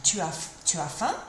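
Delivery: restart, then lift "faim" to ask it; statement turned question, "faim" going up at the end; rising intonation. Shown as a demonstration. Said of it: "the statement rising intonation".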